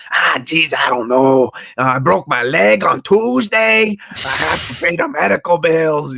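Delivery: high, loud Brooklyn whine; Brooklyn accent. Acted. Showing how to stall debt collectors, whiny Brooklyn accent. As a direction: plead hammily as a Brooklyn guy. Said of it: "You have to talk like that".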